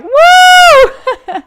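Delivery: very high, loud triumphant whoop. Retold or imitated. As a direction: let out a victorious whoop stepping out. Getting out of cold shower.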